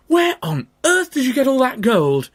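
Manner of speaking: high, loud astonished mum; amazed mother. Acted. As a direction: gasp at the gold as mother. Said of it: "she asked".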